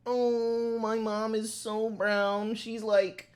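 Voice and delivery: high, drawn-out comedian impression; mock a stand-up comic in a high, sustained voice; Aziz Ansari. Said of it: "Like when Aziz Ansari starts being like".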